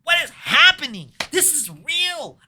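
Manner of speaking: very high, shrieking mock terror ending in a scream; hammed-up panic. How the actor should have reacted, mock panicked shriek. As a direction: ham up a horrified yell. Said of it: "He should be like".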